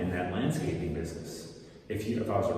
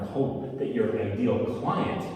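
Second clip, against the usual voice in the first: normal talking voice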